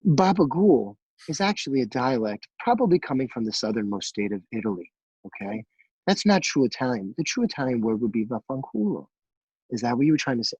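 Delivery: low, measured mock-polite correction; sweetly schooling. Cook correcting a customer's Italian, mock-sweet lecture. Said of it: "I didn't say it as sweet and soft as I did it to you".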